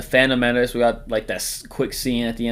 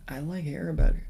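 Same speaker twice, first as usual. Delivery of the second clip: soft put-on voice; 4 semitones higher, 5 dB quieter than usual. Repeated in odd soft voice.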